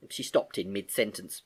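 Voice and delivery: plain reading voice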